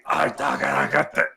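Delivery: loud, forced, throat-wrecking demo; vocal-cord-straining. Shown as a demonstration. Voice student showing how not to project, straining loudly. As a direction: push it harshly from the throat. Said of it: "destroying your vocal cords".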